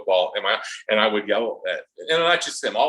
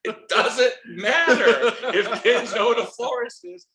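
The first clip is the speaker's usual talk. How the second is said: high, slow laughing comeback; laughing retort; 11 semitones higher, slower than usual. Retold or imitated. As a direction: laugh it off as you insist. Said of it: "I'm like".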